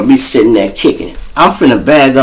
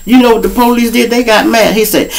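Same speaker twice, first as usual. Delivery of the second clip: ordinary talk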